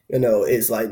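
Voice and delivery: ordinary talk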